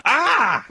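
high laughing yelp; stabbed scream. Retold or imitated. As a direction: yelp like you were stabbed. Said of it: "Caesar's actually first words after being stabbed".

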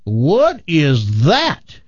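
high whoop, then a slow gawking question; amazed Texan. Acted. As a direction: gape at the arch in awe. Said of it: "And he says".

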